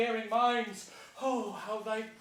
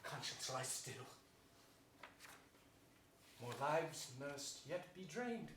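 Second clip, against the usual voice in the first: much softer and lower, sinister; dark hushed resolve; 7 semitones lower, 16 dB quieter than usual. Acted. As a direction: hush your own conscience. Richard's dark private resolve.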